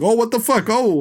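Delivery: high, hammed-up Guido bartender; mock bartender. Host mocking Cannavale's Guido bartender, hammed-up and high. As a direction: toss it off like a cartoon bro. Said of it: "him just being like".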